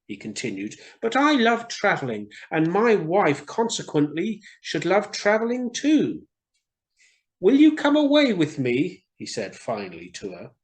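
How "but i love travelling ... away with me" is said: higher, louder bird voice for the swallow; swallow's voice. Acted. Swallow explaining to the reed, higher and louder. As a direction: voice the swallow up high.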